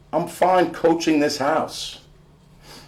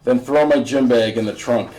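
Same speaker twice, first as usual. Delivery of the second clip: ordinary delivery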